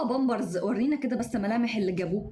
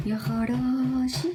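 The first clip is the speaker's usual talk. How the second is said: drawn-out exclamation; 3 semitones higher, much slower than usual. Real, not acted. Exclaims at hoped-for gift.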